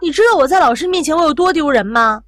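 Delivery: loud, high retold outburst; angry scolding. Daughter shamed before the teacher, scolding her mother angrily. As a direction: act out an indignant daughter's rebuke. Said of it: "正在气头上".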